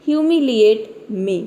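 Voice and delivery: each word said very slowly and apart; slow, deliberate teacher's reading; slow clear model sentence. Shown as a demonstration. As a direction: read it out slowly for learners to copy. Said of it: "मैंने यहाँ पे pronounce कर दिया".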